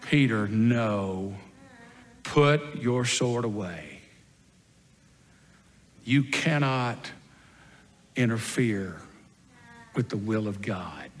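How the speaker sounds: slow, low, measured command; grave restraint. Acted. Jesus stopping Peter's sword, grave restraint. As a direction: spell it out slowly, sinking to a hush.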